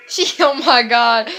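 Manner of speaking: loud, laughing in disbelief. Real, not acted. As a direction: laugh it off as you remember. Laughs recalling the stabbing attempt.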